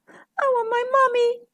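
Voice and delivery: very high, small duckling whimper; tiny, teary baby voice; tearful. A narrator's reading. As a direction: wail it like a lost little child. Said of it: "A fat little tear rolled down".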